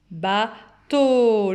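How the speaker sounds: "Bateau" split, with a long, strong "teau"; final syllable stressed. Shown as a demonstration. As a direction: say "ba", pause, then hold and push "teau". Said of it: "le son O est bien sur la dernière syllabe".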